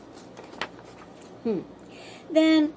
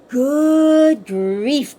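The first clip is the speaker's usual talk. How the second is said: a loud, exasperated groan; 2 semitones higher, 8 dB louder than usual. A narrator's reading. Star-bellied Sneetches groaning at the copycats. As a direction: sigh it out like a fed-up snob. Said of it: "groaned the ones who had stars".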